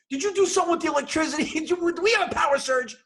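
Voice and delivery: high, fast frantic rant; flipping out. Retold or imitated. Husband demanding of his wife what happened to the electricity, frantic flipping out. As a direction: fire off panicked accusations loudly. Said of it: "I'm flipping out".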